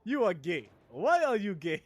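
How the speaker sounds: loud mock Nigerian accent; Nigerian accent impression. Streamer quoting a meme interviewer, loud Nigerian accent. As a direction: accuse in an imitated Nigerian accent. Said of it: "He sounds Nigerian. With that accent".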